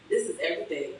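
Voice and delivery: normal talking voice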